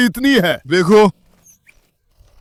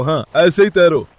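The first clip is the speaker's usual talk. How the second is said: ordinary talk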